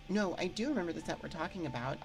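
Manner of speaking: ordinary talk, nothing marked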